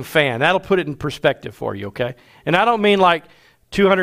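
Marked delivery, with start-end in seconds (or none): none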